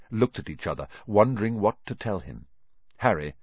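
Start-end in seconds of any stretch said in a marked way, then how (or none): none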